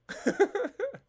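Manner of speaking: short real high laugh. Real, not acted. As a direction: giggle at the bit. Laughs at own joke.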